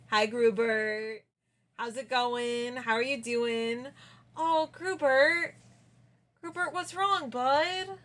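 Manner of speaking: tender coaxing. Pretend. Streamer checking in on Grubert, tender coaxing. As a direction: check on a little buddy, concerned.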